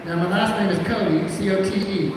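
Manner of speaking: ordinary delivery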